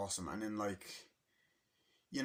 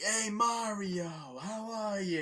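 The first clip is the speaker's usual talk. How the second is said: higher-pitched mocking hello, slow on Hey Mario; mock greeting; 12 semitones higher, 5 dB louder, slower than usual. Retelling a relative greeting Mario, mock cheerful. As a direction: imitate a fake-friendly relative. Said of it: "it's like".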